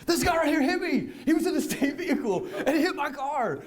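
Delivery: very high, laughing whiny accusation; the other driver blaming the secretary to the officer, very high and whiny; accusing other driver. Retold or imitated. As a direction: point the finger in a high, whiny mock voice. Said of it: "and the other guy goes".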